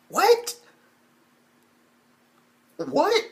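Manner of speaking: very high-pitched, baffled yelp; incredulous disbelief. Retold or imitated. Fan stunned that she's crying, high incredulous yelp. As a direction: squeak it out in utter disbelief.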